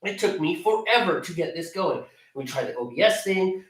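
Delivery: ordinary delivery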